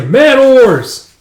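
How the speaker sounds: shouted command. Acted. Captain to the men at the oars, shouting. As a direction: call out the order to the crew.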